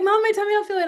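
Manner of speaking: mock child voice; child whine. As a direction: play the kid with tummy ache. Child complaining.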